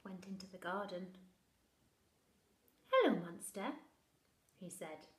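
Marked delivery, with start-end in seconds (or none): 2.9-3.7 put-on loud, calm boy's hello; child's greeting. Bernard greeting the monster, mildly friendly.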